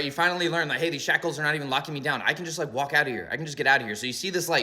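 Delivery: usual speaking voice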